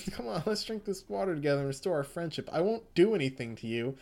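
lightly voiced creepy persuader; coaxing madman. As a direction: talk the cat down with a false promise. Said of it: "It's like".

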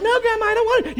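put-on the boy pleading with grandma in a high whine; whiny child. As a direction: plead like a five-year-old. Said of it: "I'm like".

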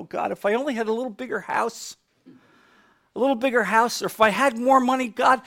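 imitated bargaining prayer. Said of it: "there are people praying like this".

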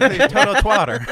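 teasing laughter; laughing. Real, not acted. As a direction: blurt it through a laugh. Joking amid laughter.

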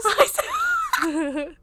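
high laughing mock greeting; shouted giggly sorority hello; sorority girl voice.

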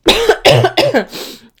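loud hacking cough; a harsh, loud throat cough; real cough. Real, not acted. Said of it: "'cause I need 'em".

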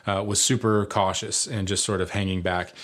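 normal talking voice

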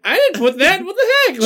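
high, rising disbelieving outburst; incredulous. Retold or imitated. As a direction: protest it in shocked disbelief. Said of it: "I'm like".

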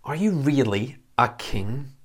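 a light sarcastic doubt; lightly mock the prisoner's claim; understated sarcastic question; mild sarcasm. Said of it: "a bit of sarcasm here".

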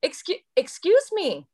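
high, drawn-out "excuse me" to pupils; non-hollering teacher reprimand. Retold or imitated. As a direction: interrupt misbehaving kids without hollering. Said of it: "I'm not a hollerer".

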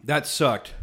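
ordinary talk

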